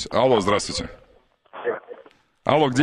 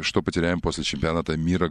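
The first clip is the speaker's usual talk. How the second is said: ordinary talk, nothing marked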